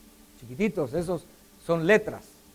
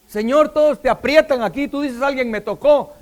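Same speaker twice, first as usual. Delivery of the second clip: higher, louder acted objection; incredulous protest; 7 semitones higher, 9 dB louder than usual. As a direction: protest loudly, pitched up in disbelief. Acted protest of disciples to Jesus. (protesta incrédula, en voz alta y aguda)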